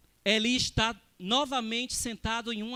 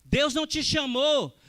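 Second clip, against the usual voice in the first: loud, raised, rhythmic pulpit cadence; impassioned preaching; 2 semitones higher, 5 dB louder than usual. Real, not acted. Preacher tells the congregation why God called them, impassioned preaching. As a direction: press each phrase on the congregation with force.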